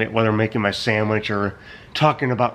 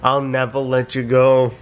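laughing; slower than usual. Acted. Reading meme, laughing.

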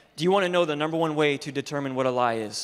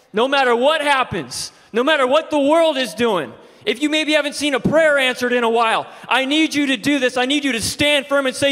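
loud, much higher, fervent preaching; 10 semitones higher, 6 dB louder than usual. Acted. Pastor urges the church to stand firm, preaching loud. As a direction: stir the room to stand firm.

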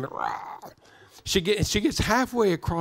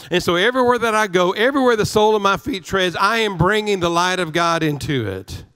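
rising, driving preacher cadence; fervent preaching; 3 semitones higher, 3 dB louder than usual. Acted. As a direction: preach it with building conviction. Preaching at full force.

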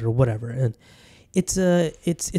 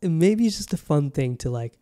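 ordinary talk

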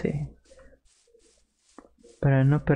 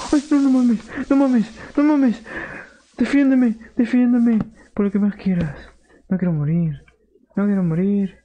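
súplica aterrada; 7 semitones higher than usual. Pretend. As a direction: plead for your life in a squeaky villager voice. Villager begging for life. (súplica aguda y aterrada de aldeano)

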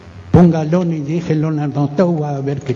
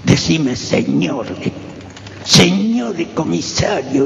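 slow, whispered menace from a policeman; torturer's insults. Retold or imitated. Survivor imitating the comisario's insults, slow whisper. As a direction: hiss the insults slowly like the cop who beat him.

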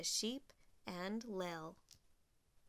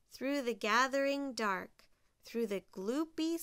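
ordinary delivery, nothing marked

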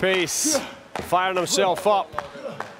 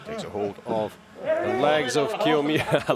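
ordinary talk, nothing marked

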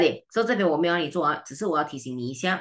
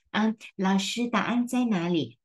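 higher, whiny student voice; mock student whine; 6 semitones higher than usual. Teacher mimics student begging for answers, higher whiny voice. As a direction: play the clueless student asking for answers.

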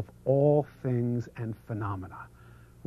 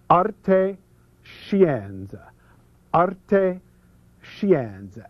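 Italian accent; 4 semitones higher, much slower than usual. Shown as a demonstration. Speaker introducing a principle to an audience, theatrical Italian accent. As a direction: savour both words in an Italian accent. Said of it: "all together in Italian".

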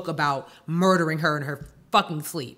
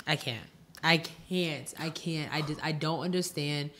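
overwhelmed; 3 semitones lower, 8 dB quieter than usual. Real, not acted. Overwhelmed and speechless at the news.

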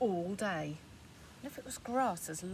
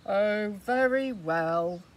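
louder, slow reluctant agreement; grudging troll; 10 dB louder, much slower than usual. Acted. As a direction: give in grumpily. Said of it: "said the troll".

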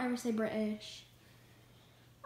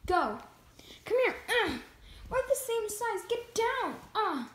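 normal talking voice

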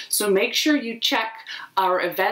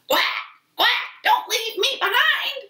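very high quacking duck; very high little duck pleading. Acted. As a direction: quack it high, afraid of being left. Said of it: "says Duck".

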